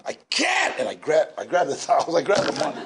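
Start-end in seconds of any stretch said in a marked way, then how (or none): 0.1-0.8 sharp high yelled protest; screamed. Retold or imitated. Refusing the uncle's heroin, a sudden high yell. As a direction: yell it out in a burst. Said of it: "I just screamed".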